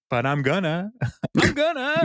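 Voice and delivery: put-on whiny childish "I'm gonna", rising high; like a stubborn little kid; sing-song little-boy insistence. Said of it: "Like a little child".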